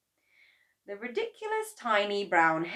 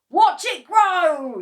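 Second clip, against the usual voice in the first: slow, high, very loud shout; 13 semitones higher, 16 dB louder, slower than usual. A narrator's reading. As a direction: cry it out in triumph. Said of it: "shouted Mister Cranky".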